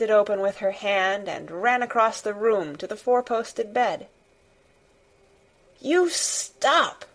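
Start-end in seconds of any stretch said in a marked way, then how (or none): 5.8-7.1 high, drawn-out angry command; a girl's furious near-shout, pitched well up; almost shouting. A narrator's reading. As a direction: order it high and hard, just short of a shout. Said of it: "she almost shouted".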